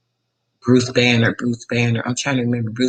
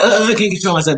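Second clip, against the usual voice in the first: high-pitched mock outburst; hammed-up upset whine; 9 semitones higher, 4 dB louder than usual. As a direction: act out someone losing control. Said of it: "he get upset and he's like".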